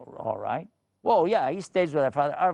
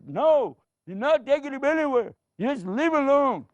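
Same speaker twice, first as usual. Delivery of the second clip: refusing father; 12 semitones higher, 4 dB louder than usual. Acted.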